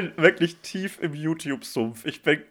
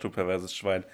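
mock disgusted insult; 6 semitones lower, 4 dB quieter than usual.

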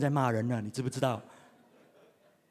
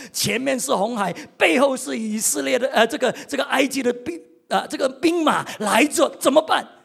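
building higher and louder to a cry; rising alarm; 5 semitones higher, 6 dB louder than usual. Retold or imitated. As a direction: let the panic build to the question. Dramatic retelling of the trap at the Red Sea. (越说越急的惊慌)

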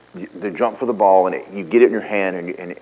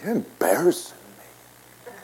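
mock whiny complaint; 8 semitones higher, 6 dB quieter, slower than usual. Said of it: "roll the window down and go".

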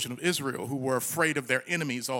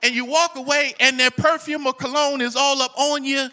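raised, loud preacher's voice; ringing sermon delivery, higher and louder; full-force preaching; 8 semitones higher, 7 dB louder than usual. Real, not acted. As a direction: preach it out to the congregation.

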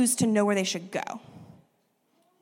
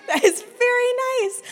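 laughing; 12 semitones higher, slower than usual. Real, not acted. Child offers himself as gift.

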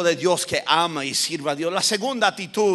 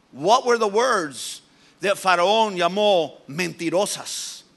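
ordinary talk

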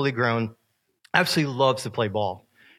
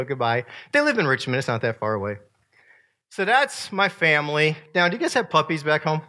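normal talking voice

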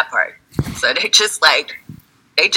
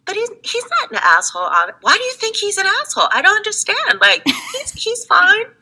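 put-on defensive girlfriend. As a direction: innocently defend the boyfriend. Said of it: "And they're like".

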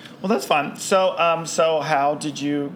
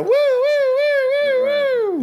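high, drawn-out siren whoop; imitated alarm siren; 5 dB louder, much slower than usual. Host mocking up an alarm for the show, siren whoop. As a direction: wail "woo" like an alarm, stretched and high. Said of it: "I should have, like, a".

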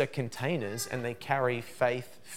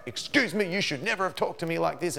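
mock stern rebuke; 5 semitones higher than usual. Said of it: "first time obedience is like".

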